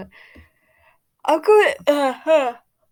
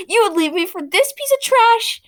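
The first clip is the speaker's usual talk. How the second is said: much higher and louder, scornful; jealous outrage; 10 semitones higher, 8 dB louder than usual. Acted. Jilted girl to her ex, outraged and scornful. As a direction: spit the insult out.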